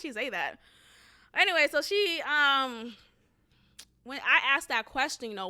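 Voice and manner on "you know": ordinary talk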